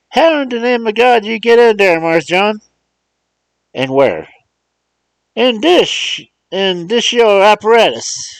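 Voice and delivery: put-on high, loud old man's dialect voice; old servant astonished at the talking box; amazed old Uncle Remus. Uncle Remus asks Mars John through the phone, amazed.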